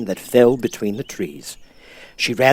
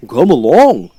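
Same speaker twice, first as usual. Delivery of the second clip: louder, hearty welcome; 4 semitones higher, 11 dB louder, slower than usual. Acted. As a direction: call them along with gusto. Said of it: "said the Scarecrow heartily".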